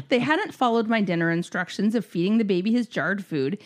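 ordinary talk, nothing marked

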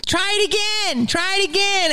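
high, taunting imitation of a heckler; mock the crowd's demand. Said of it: "Would you tell Simone Biles".

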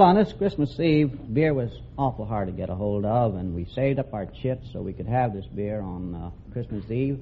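ordinary talk, nothing marked

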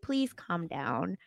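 soft, slow plea to calm down; slow it down and hush her gently; soothing plea. Retold or imitated. Team pleading with Davy.

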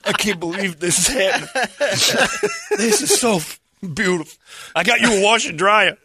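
laughing hard while joking. Real, not acted. Laughing while mocking the gift.

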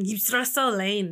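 slow, hammed-up Austrian accent on Jebstresse Lane; mock Austrian accent. Host jokingly claiming her accent is Austrian, slow mock Austrian accent. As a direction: playfully put on an Austrian accent, stretching it slow. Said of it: "That's my accent, Austrian".

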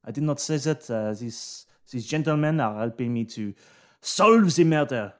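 put-on lightly French-accented servant; a hint of a flustered French valet pleading; French butler. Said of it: "Bercule says".

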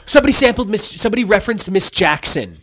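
loud, high burst as memory clicks; sudden recall. Real, not acted. Co-host finally remembers the song reference, loud sudden recall. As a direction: blurt out the answer triumphantly.

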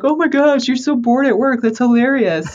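high-pitched mock gushing; imitating amused friends.

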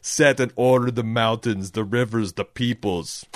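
grand declamation, easing off at the end; Arnold Schwarzenegger. Acted. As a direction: bring the action-hero incantation to a close. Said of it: "sounds like I'm doing an Arnold Schwarzenegger".

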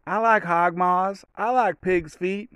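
high, slow, drawn-out movie-dad voice; Friday dad impression. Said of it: "those are just the parts I remember".

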